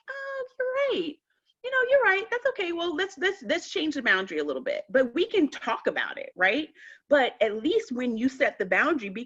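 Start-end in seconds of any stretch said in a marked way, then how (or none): 0.1-4.8 giving in. Retold or imitated. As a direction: cave in sweetly, a bit hammed-up. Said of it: "I'm like".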